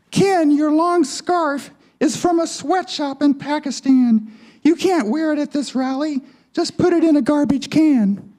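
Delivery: high-pitched puppet-show woman; Barbie's feminine twang. Acted. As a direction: scold in a high doll voice. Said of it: "In her feminine twang".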